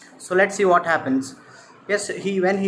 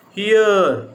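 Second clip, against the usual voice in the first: louder, drawn-out call; 3 semitones higher, 6 dB louder than usual. Acted. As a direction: call after someone walking away. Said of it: "just called".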